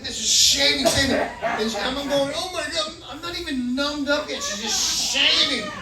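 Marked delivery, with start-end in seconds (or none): 2.3-4.4 higher-pitched dismayed protest; mock panic at rough treatment; acted alarm. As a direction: plead in comic distress. Acted dismay while being shaved.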